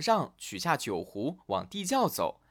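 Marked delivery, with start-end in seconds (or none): none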